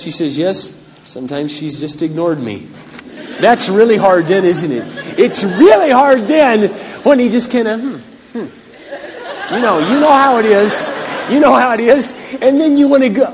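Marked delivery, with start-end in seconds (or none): 3.4-13.2 emotional preaching. Acted. Loud high emotional preaching.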